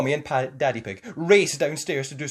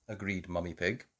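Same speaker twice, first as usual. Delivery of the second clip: ordinary delivery, nothing marked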